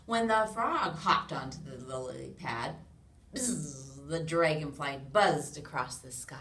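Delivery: loud, lively storybook read-aloud; animated picture-book narration; reading to children. A narrator's reading. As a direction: read it big for a room of little kids.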